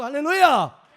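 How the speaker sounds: loud, high-pitched preacher's cry; rousing full-force acclamation; exultant shout. Real, not acted. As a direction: burst out in praise. Preacher calls out to congregation.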